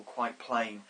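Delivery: ordinary talk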